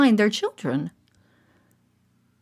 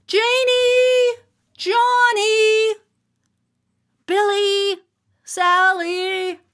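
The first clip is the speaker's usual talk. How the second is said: high, sing-song calling of each name; 13 semitones higher, much slower than usual. A narrator's reading. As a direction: call the lost children one by one. Parents calling children's names.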